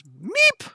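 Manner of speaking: very high squeaked "meep"; tiny meep. Acted. Game master voicing a wounded foe, high squeak. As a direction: squeak it out in one little peep. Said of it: "he goes".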